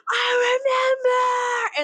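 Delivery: high, drawn-out reenacted scream. Viewer acting out screaming during the movie, drawn-out high scream. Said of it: "I was screaming".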